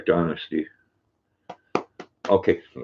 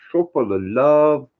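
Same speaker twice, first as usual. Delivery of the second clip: mock doting voice; 4 semitones higher than usual.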